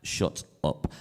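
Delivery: low-volume voiceless whisper; whispered aside. Real, not acted. Cutting off one's own stage patter, whispered aside. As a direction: slip it in as a whisper. Hushed aside between remarks.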